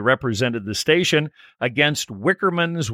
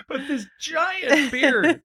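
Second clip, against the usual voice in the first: laughing; 12 semitones higher, slower than usual. Real, not acted. Laughing about the beard.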